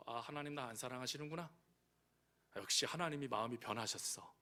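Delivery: a wounded believer murmuring to God; hurt mutter. Acted. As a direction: sulk quietly to yourself.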